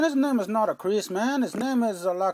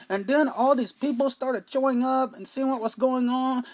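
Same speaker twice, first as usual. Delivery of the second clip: ordinary delivery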